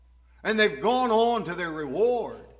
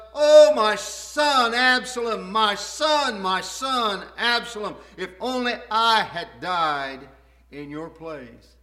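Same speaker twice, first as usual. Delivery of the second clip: grieving lament; 5 semitones higher, 9 dB louder than usual. Acted. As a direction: wail it out as a broken king mourning his son. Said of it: "Then the king was deeply moved".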